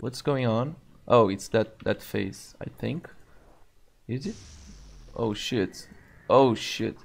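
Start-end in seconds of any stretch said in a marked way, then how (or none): none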